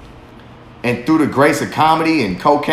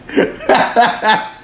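real laugh; 9 semitones higher than usual. Real, not acted. Laughing at his own imagined quote, real laugh. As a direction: burst of laughter, high.

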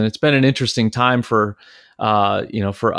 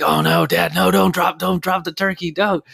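put-on high, urgent kids begging dad not to drop it; playful panicked plea; panicked pleading; 8 semitones higher than usual. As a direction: cry out high in mock alarm. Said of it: "we're like".

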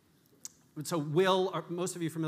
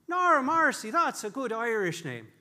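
loud, high Irish barman voice; beaming Irish barman, pitched way up; bad Irish accent; 10 semitones higher, 7 dB louder than usual. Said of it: "are you ready for a bad Irish accent too".

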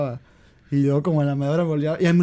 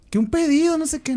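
called out, higher; calling out to mom; 11 semitones higher than usual. Retold or imitated. Son calling to his mom across the house, higher call.

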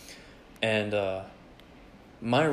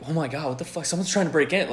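rising, alarmed retelling of fright; mock panic; 6 semitones higher than usual. Teller replays fearing a midnight intruder, mock panic. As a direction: act out mounting alarm, voice climbing. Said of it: "like, a panic attack a little bit".

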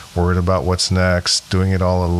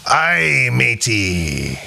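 hearty pirate growl; 3 semitones higher, much slower than usual. Pretend. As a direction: talk like a pirate. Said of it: "I love [name]'s pirate voice".

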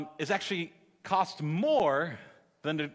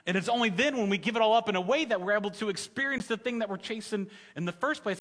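ordinary delivery

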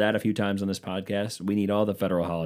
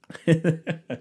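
high laugh; 8 semitones higher than usual. Real, not acted. Laughs at own bit.

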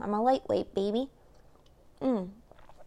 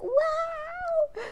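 much higher, drawn-out amazed wow; awed wow. Retold or imitated. Watching her buddy's fight, a high held awed wow. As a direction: gape at the fight in wonder. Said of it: "I'm just sitting there like".

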